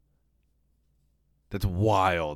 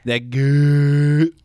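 mock echo; 3 semitones higher, 9 dB louder than usual. Echoing the goo sound.

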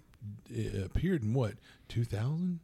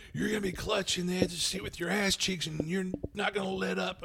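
high, tense action-movie hype; mock breathless thriller intensity; hyped-up; 8 semitones higher than usual. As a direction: pitch up high and keep it there. Acting Michael Bay thriller excitement.

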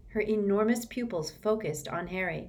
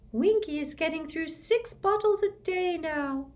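high elf voice, voiced not whispered; a high little house elf confiding; squeaky elf voice; 12 semitones higher than usual. Dobby confiding to Harry, high squeaky elf. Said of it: "Dobby whispered to Harry".

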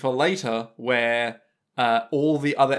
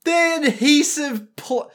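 high, slow repetition; trying out the word in disbelief; stunned echo; incredulous; 10 semitones higher, 3 dB louder, slower than usual. Real, not acted. Repeating the term in shock.

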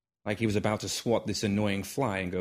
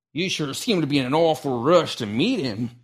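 put-on old man's voice; 5 semitones higher, 6 dB louder than usual. Old man needling Hiccup.

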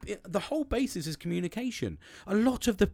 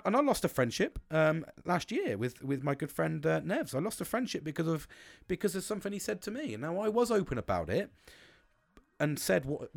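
ordinary talk, nothing marked

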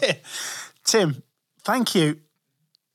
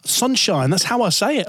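higher, incredulous defence; indignant protest; 7 semitones higher than usual. Retold or imitated. Presenter retelling defending his Hull accent, indignant higher protest. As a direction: bristle at the criticism, higher. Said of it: "And I'm like".

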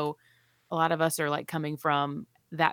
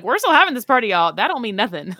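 defiant family; 5 semitones higher, 9 dB louder than usual. Retold or imitated. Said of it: "So it was like".

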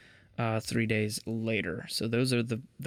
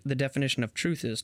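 ordinary talk

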